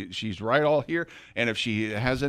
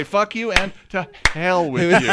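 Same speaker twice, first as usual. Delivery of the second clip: mock curse; 6 semitones higher, 7 dB louder than usual. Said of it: "You should say".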